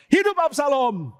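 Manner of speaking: seruan tinggi, lebih pelan; seruan lantang. Acted. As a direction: ulangi seruan dengan mantap. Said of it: "dia langsung teriak". (Husai mengulang seruan kepada Absalom, tinggi dan lambat)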